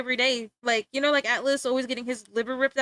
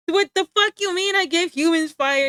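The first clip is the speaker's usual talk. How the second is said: loud, raised, incredulous protest; comic affronted outburst; mock indignation; 6 semitones higher, 7 dB louder than usual. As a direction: protest the accusation with hammed-up outrage. Acted indignant denial in imagined argument.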